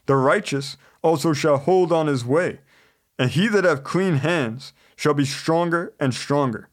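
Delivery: ordinary delivery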